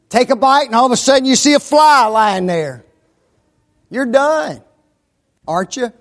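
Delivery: loud, fast revival preacher; fiery preaching. Acted. Preaching at full force.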